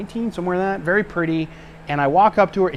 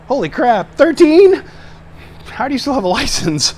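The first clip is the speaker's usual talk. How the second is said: incredulous; 11 semitones higher, 7 dB louder than usual. Real, not acted. Laughing incredulous comment.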